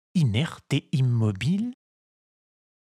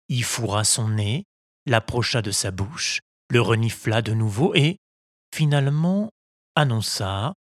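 plain reading voice

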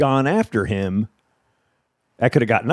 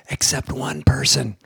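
stage whisper; slower than usual. Retold or imitated. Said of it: "stage aside".

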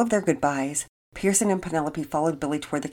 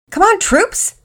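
high, loud, rallying dad; jubilant; 11 dB louder, slower than usual. A narrator's reading. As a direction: cheer the kids on like a proud troop leader. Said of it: "Daddy Peters was jubilant".

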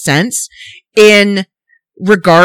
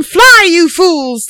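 Fly drawn out slowly and hit hard; stressed fly; 9 semitones higher, much slower than usual. Retold or imitated. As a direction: lean slowly on Fly, you fools. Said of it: "why would he tell them".

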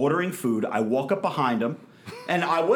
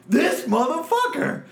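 pitched way up, laughing in disbelief; outraged scoff; 12 semitones higher, 3 dB louder than usual. Retold or imitated. Reading the rude note on his windshield, outraged.

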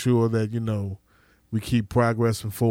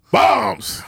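shouted catchphrase; 8 semitones higher, 3 dB louder than usual. Real, not acted. Podcast catchphrase called out.

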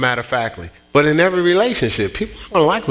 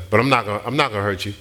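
calm reassurance; 5 semitones lower, much faster than usual. Retold or imitated. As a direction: settle him down, low and even. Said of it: "I said it exactly like that".

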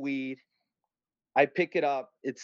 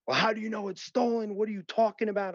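retold yelling; 7 semitones higher, faster than usual. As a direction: imitate an irate operator at a raised pitch. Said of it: "they start screaming at me".

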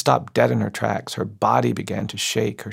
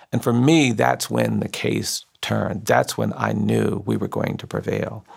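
ordinary delivery

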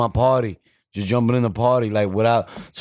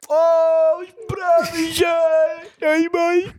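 excited player shout; much slower than usual. Retold or imitated. Player answering the fan, high excited shout. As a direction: shout back like a pumped-up player.

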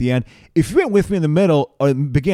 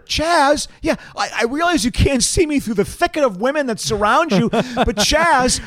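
much higher, calling out for Chaz; swooning women; 13 semitones higher, slower than usual. Acted. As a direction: lovestruck woman pleading for his attention. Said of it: "impression right now of the women".

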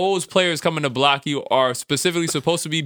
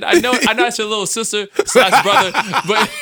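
laughing; 7 semitones higher, 5 dB louder than usual. Real, not acted. Laughs through the line.

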